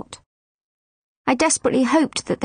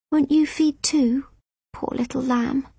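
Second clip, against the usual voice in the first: gentle voice; 3 semitones higher than usual. Acted. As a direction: coax a baby like a lamb. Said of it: "This was a gentler voice".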